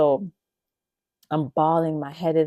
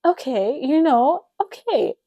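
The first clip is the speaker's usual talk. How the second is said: unconvinced okay; 9 semitones higher, 6 dB louder than usual. Retold or imitated. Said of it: "And I was like".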